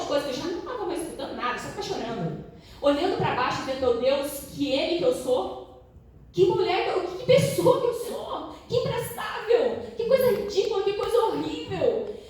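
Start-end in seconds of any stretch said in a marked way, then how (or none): 3.8-12.2 crying at herself after the harsh feedback; tearful self-pity. Retold or imitated. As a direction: moan it like you're crying. Her self-pitying crying thoughts.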